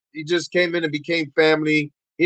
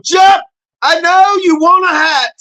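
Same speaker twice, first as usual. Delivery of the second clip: high, louder call to a viewer; 14 semitones higher, 6 dB louder than usual. Real, not acted. Streamer calling out to viewer Jeff, high and loud. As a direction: call out brightly to him.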